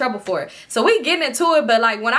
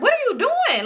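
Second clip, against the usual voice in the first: high, exclaimed mock disbelief; incredulous challenge; 13 semitones higher than usual. Storyteller replaying her question to Toxic, high-pitched and incredulous. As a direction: act out demanding an explanation, voice pitched way up.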